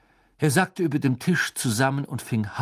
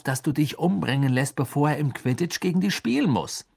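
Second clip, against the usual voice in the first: higher, insistent arguing voice; exasperated friend; 5 semitones higher than usual. Acted. Friend urges Harry to see through Malfoy, exasperated. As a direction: press the point, pitch raised throughout.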